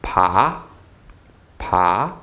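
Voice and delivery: PA starting low and rising; rising pitch on PA; second tone. Shown as a demonstration. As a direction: lift PA like a question. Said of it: "Second tone again".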